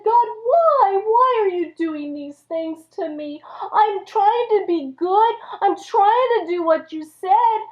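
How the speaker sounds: high, plaintive wail to God; acted desperate complaint; anguished plea. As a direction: plead in hurt bewilderment. Job pleads with God.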